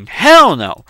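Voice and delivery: imitating an indignant, stubborn sister; stubborn refusal. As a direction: refuse stubbornly, leaning hard on HELL. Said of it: "she was very stubborn".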